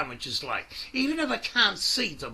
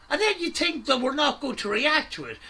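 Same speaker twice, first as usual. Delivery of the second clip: normal talking voice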